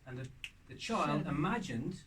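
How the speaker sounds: usual speaking voice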